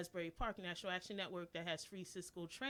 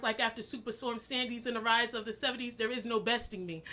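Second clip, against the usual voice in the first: measured, rhythmic poetry recital; spoken word poem; 4 semitones higher, 13 dB louder than usual. Acted. Poet reciting her poem to the open mic crowd, rhythmic spoken word. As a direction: perform the poem with steady cadence.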